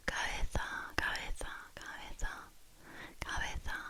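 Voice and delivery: cabeza with the B softened almost away, kah-EH-thah; whispered cabeza with a barely-there B; lazy B. Shown as a demonstration. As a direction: let the B in cabeza melt. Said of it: "kind of lazily say the B".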